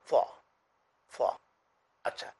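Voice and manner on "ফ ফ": ফ said twice with rounded lips; rounded mouth letter. Shown as a demonstration. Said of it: "মুখ গোল করে উচ্চারণ করা". (মুখ গোল করে ফ)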